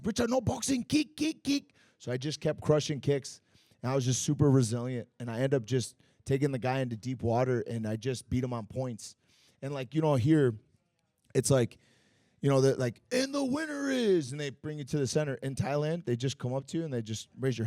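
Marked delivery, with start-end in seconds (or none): 0.0-1.7 high-pitched imitation of Thais calling instructions; Thai cornermen urging. Thai trainers urge their boxer to change tactics, high mimicked calls. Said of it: "So the Thais". 13.1-14.3 high, drawn-out announcer call; ring announcer imitation. Announcer about to name the fight's winner, high drawn-out mock call.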